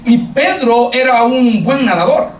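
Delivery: ordinary delivery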